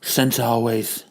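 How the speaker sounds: low, soft put-on wife voice; a weary wife handling everything. Wife answers principal.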